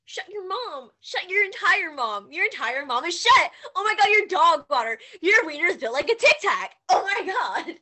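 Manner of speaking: high, loud hammed-up insults; hammed-up angry roast; mock trash talk. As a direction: yell playful insults at your friend.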